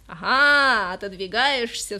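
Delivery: loud sudden cry, dragging out the accusation; accusing shout. Acted. Dimochka shouts at his girlfriend for pulling away. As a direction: catch her out and shout it. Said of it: "вскричал Димочка".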